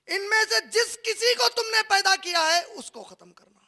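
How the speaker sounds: high, loud solemn decree; proclaiming condition. Acted. God sets a condition for the elements, loud decree. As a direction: announce the condition with force. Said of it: "अल्लाह ताला बोले एक शर्त है".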